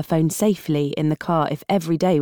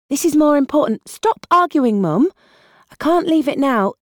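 raised, insistent daughter on the phone; urgent young woman; 8 semitones higher than usual. Acted. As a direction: press hard, no time to argue. Ellie argues with mum on phone.

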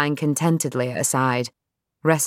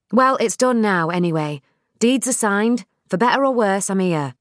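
Nell standing her ground with her dad, higher and defiant; 5 semitones higher than usual. Acted. Nell's voice.

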